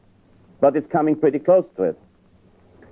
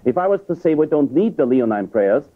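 usual speaking voice

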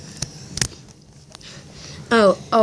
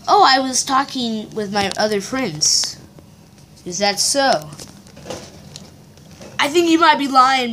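ordinary talk

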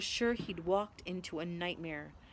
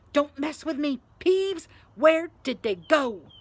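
loud, higher, exasperated questioning; angry demand; 6 semitones higher, 8 dB louder than usual. A narrator's reading. Filch to teasing Peeves, loud angry demand. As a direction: warn him, then demand hard.

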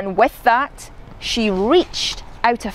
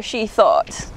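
ordinary delivery

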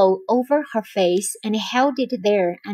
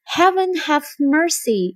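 higher-pitched plea; mildly fearful, raised voice; frightened prayer; 5 semitones higher than usual. A narrator's reading. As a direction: plead to heaven in fear. Frightened Desdemona prays for mercy.

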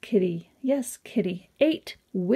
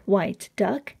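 ordinary delivery

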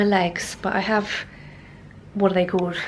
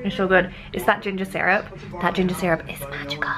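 ordinary delivery

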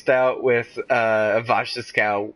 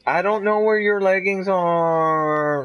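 mock whiny voice; 9 semitones higher, slower than usual. Mock voice aside, high and slow.

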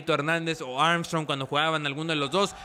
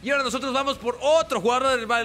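big announcer reveal; 8 semitones higher, 4 dB louder than usual. Acted. Host revealing the winter signing to viewers, excited announcer shout. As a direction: shout the star's name like an announcer.

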